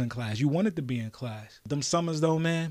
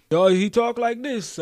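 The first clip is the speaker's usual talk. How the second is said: put-on Italian accent; 9 semitones higher, 5 dB louder, slower than usual. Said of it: "like he just came off the plane from Italy".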